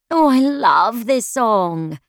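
high, loud and slow delight; excited cry. A narrator's reading. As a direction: gush over the song. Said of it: "I cried as Queen started playing".

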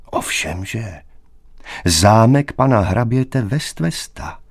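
measured reply, weight on the count's name; slow, deliberate answer. A narrator's reading. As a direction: say it with deliberate care. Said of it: "pravil mladík pomalu". (pomalu a důležitě)

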